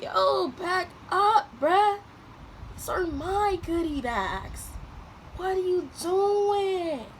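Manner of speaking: high, slow indignant scold; mock outrage. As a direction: push him off, slow and offended. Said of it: "I was like".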